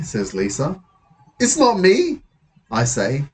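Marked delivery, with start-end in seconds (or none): 1.4-2.2 higher-pitched defensive protest; indignant denial. Acted. Boy to friend about wanted poster, defensive. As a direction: deny it indignantly, voice up.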